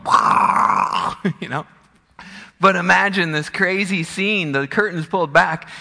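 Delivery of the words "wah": loud, drawn-out wah noise; hammed-up backstage sound effect; big voiceless roaring yell; imitated storm roar. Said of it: "Some other kids going".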